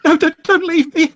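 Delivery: very high, loud panicked cry; begging not to be left alone; trapped woman begs Jacob not to leave, panicked; desperate plea. Acted.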